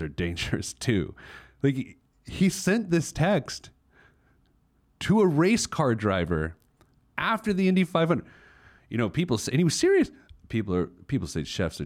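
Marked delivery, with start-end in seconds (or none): 1.6-8.3 can't believe it, stretched out; incredulous. Real, not acted. Incredulous retelling, high pitch. 9.5-10.1 squeaky high disbelief; incredulous. Real, not acted. Disbelief at friend's text.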